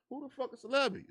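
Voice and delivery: very high, loud confusion; baffled brother. Retold or imitated. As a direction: shriek out total confusion. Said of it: "He like".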